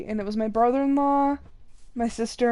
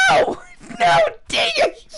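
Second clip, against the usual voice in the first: much higher, louder shout with laughter; frustrated shout; 6 dB louder than usual. Real, not acted. Gamer shouting as her pig escapes, laughing frustration. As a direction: yell as the pig gets away.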